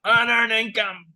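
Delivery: lightly hammed bureaucrat voice; mocking bureaucrat. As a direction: parody the worker's stiff verdict. Throwing unicorns, repeating mockery.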